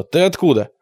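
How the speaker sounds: ordinary delivery, nothing marked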